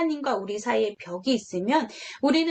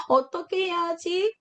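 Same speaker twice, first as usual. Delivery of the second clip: slow, high, fretful question; a child at a loss, wringing their hands; mild worried child; 7 semitones higher than usual. Retold or imitated. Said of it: "이렇게 여러분은 걱정하게 될 거예요".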